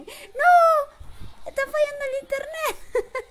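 very high, loud wail; panicked scream. Real, not acted. Streamer as the internet fails mid-game, panicked scream. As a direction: scream it in alarm.

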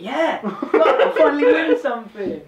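loud, high, laughing cheer; excited cheer. Real, not acted. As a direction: celebrate the win. Winning the challenge.

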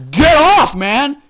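loud, high scolding yell; hammed-up indignant holler; mock outraged shouting. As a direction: tell them off like misbehaving kids. Scolding the fighting toys.

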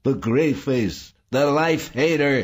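high-pitched hateful cry; shrieking. A narrator's reading. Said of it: "Saul shrieked".

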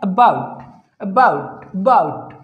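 "अबाउट" with a barely-there first uh; very light schwa. Shown as a demonstration. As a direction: weaken the start of "अबाउट", lean on bout. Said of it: "बहुत ही हल्का सा अ".

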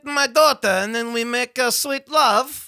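comic Kazakh character voice; Borat. Acted.

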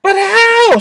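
high, eager little angel; sweet bubbly cherub voice. A narrator's reading. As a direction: squeak it out like a cherub.

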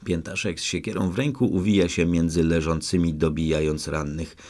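ordinary delivery, nothing marked

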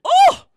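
very high startled shriek. Acted. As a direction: shriek out in sudden pain. Cry on skewering herself.

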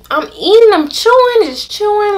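high bewildered voice; puzzled chewing. Real, not acted. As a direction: act out chewing something wrong. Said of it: "acting out chewing confusion".

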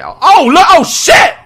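high, loud frightened yelp; startled scream. Real, not acted. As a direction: shriek at the sudden scare. Jump scare.

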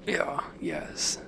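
whispered yes; whispering. Real, not acted. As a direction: breathe it out under your breath. Quiet celebration.

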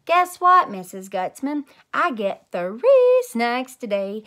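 loud, high, slow brag; excited bragging. Acted. Junie B bragging to Mrs Gutzman, loud and excited. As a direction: crow about the extra snacks.

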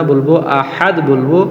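usual speaking voice

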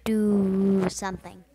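loud, slow insisting. Real, not acted. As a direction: push hard on DO. Louder urging.

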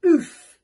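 high, loud oof; impact grunt. A narrator's reading. Teacher hitting the ground, grunting oof loudly. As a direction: blurt a cartoon oof.

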